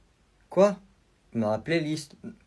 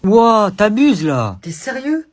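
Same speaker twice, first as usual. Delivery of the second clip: outraged; 6 semitones higher, 11 dB louder, much slower than usual. Acted. Angry kid protesting.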